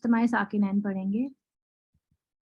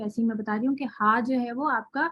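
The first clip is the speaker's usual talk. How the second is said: usual speaking voice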